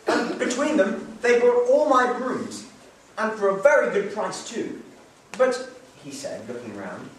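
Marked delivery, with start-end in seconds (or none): 0.1-5.7 delighted husband. Acted. Husband boasting to his wife of the sale, delighted. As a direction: savour the good price.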